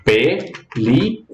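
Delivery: PELÍCULA very slowly as PE, LI, CU, LA; split into syllables. Shown as a demonstration. Teacher counting out syllables for learners, PELÍCULA said syllable by syllable. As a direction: break PELÍCULA into four separate syllables with pauses. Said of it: "Quais são as sílabas?".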